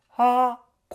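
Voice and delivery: brief operatic vowel demo; open-throat tone. Shown as a demonstration. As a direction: open the back of the throat and let "はー" ring. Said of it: "こういう声になります".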